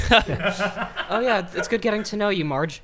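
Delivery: high, laughing fake-friendly chat; fake polite small talk. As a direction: gush politely to a neighbor. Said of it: "mock polite small talk".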